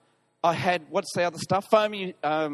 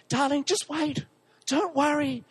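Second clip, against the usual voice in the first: wife reassuring; 6 semitones higher than usual. Retold or imitated. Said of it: "Helen texts me".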